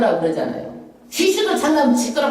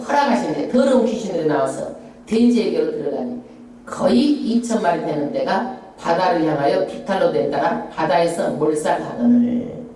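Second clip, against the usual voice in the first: scripture read aloud; 5 semitones lower than usual. Acted. Congregational scripture reading.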